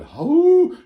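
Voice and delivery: very high, loud hoot; howler monkey hoot. Retold or imitated. Sick fellas sounding like monkeys, loud hoot. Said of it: "just like a Peruvian howler monkey".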